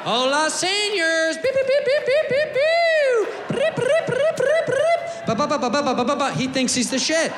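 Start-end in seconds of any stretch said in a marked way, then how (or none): 0.0-1.4 high, drawn-out gringo Spanish greeting; cocky tourist crowing bad Spanish; bad Mexican Spanish. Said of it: "talking Mexican, like". 1.4-6.3 piercing beeps, trills, then rapid ba-ba-ba; hammed-up noisy fanfare of a show-off; high mimicked beeps. Show-off's noisy entrance, mimicked.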